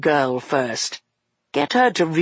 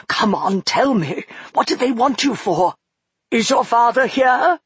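higher, anxious questioning; worried old man; 8 semitones higher, 4 dB louder than usual. Acted. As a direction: press her gently but urgently.

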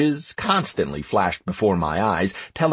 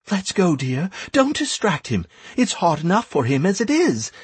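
higher-pitched gentle coaxing; lightly voiced soothing woman; 7 semitones higher than usual. A narrator's reading. Beth drawing the lad away from Mayan, lightly soothing. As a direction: ease the child away with a light voice.

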